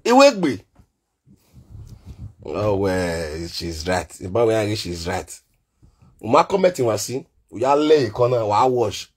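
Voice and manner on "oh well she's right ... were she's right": low, grudging she's right; mock him with a sulky low tone; low mock concession.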